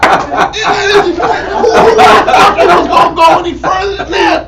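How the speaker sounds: high, loud, laughing outburst; excited laughing rant. Real, not acted. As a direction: laugh and shout through the story.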